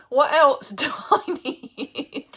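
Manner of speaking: slow, laughing what else do I need; laughing tease. Real, not acted. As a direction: giggle through a cheeky ask. Joking greedy laugh.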